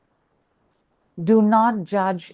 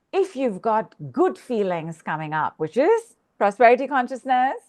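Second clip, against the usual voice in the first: ordinary delivery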